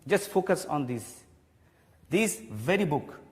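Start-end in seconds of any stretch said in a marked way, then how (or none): 2.1-3.3 very slow, "very" drawn out for emphasis; stress on very. Shown as a demonstration. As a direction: stretch and stress the word "very". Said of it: "giving more stress on this word very".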